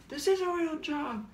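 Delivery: very high, strained squeal at moderate volume; freak-out. Retold or imitated. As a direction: squeal it in a pent-up meltdown. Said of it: "freak out".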